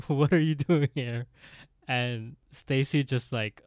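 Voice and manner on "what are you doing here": louder, higher, lightly voiced landlord; a hint of the hammed-up landlord; landlord character. Landlord opens up on Stacy, loud put-on voice. Landlord line replayed after accent bit.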